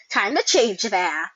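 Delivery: ordinary talk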